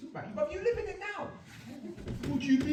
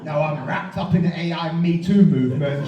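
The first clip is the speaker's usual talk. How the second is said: ordinary talk, nothing marked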